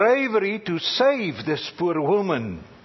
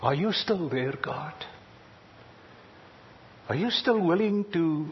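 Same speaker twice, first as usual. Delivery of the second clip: soft searching prayer; 6 dB quieter than usual. Retold or imitated. Asking God in prayer.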